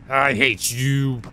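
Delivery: very slow, stretched-out grudge; drawn-out resentment. Real, not acted. Player to the lurking game character, drawn-out resentment. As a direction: draw every word out in exasperation.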